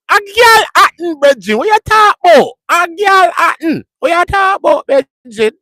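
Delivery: high, loud Jamaican shout, slow on "Galhattan"; shouted Jamaican accent. Retold or imitated. Imitating a Jamaican insisting on Galhattan, in a loud high Jamaican accent. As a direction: holler it out like an indignant Jamaican. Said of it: "That's how they say A Os and pauses".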